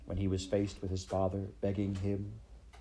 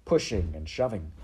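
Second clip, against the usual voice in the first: plain reading voice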